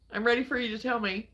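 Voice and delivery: ordinary delivery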